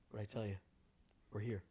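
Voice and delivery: low, soft, calm voice. Retold or imitated. Calm friend announcing arrival, low and soft. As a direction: say it quietly, totally composed. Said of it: "He said it just like that, very calmly".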